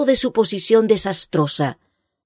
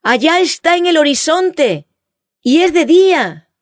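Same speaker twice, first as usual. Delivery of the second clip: high excited cry from the crew; shout; 8 semitones higher, 4 dB louder than usual. A narrator's reading. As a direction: call it out across the ship. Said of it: "se elevó un grito".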